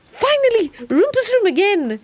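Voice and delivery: high, loud cry of relief; excited shout. Real, not acted. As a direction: cheer it out at last. Finds wanted room at last.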